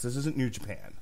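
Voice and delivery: ordinary talk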